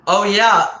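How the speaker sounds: ordinary talk, nothing marked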